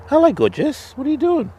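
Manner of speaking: high, sweet greeting to the dog; high dog-talk voice. Real, not acted. Fisherman greeting the farm dog, high baby-talk voice.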